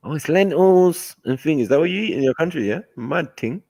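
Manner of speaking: a friend being teased over lentils, mock high voice. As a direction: lift the pitch and mock the curious schoolmate. Mocking a friend reacting to food.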